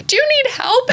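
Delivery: high, laughing imitation of a polite passer-by; imitating a stranger. Scene: a man with an umbrella offers help, high laughing mimicry.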